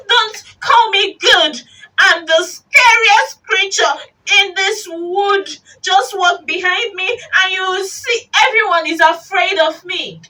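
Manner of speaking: high, loud, indignant little voice; small creature talking big; boastful mouse. Acted. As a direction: declare it boldly to the monster.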